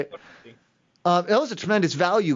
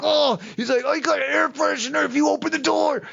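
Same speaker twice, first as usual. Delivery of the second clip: imitating the guy; 8 semitones higher than usual.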